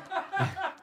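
high burst of real laughter; big laugh. Real, not acted. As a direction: let a high laugh break out. Laughs at his own joke.

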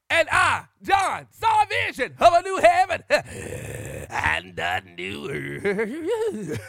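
slow, loud hammed-up Revelation preaching, laughing; mock preaching. Joking about his phone blacking out, laughing mock sermon. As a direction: preach it like a revival, half laughing. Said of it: "I'm preaching in my Batman voice".

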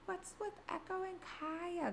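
mimicking a client's sugary question; cheery client voice. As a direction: imitate a bubbly client asking, slow and sweet. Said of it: "My clients always ask me".

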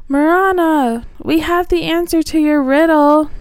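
high, loud call to the octopus; shouting into the dark forest; crying out. Acted. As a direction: call out for Morana. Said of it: "Gilly cried out".

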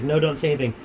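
ordinary delivery

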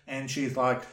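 ordinary talk

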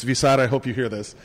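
usual speaking voice

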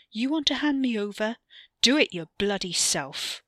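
loud scornful challenge; angry sneer. Acted. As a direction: throw it back at him. Ricky sneering at Wes.

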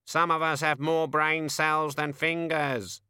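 high croaky raven voice; raven mutter. A narrator's reading. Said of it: "muttered a voice".

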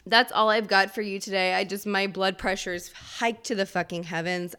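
usual speaking voice

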